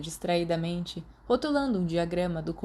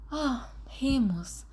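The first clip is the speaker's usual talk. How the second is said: slow, higher, worried sigh of a name; anxious; 7 semitones higher, much slower than usual. A narrator's reading. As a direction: let the worry stretch the name out. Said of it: "disse Lily, ansiosa".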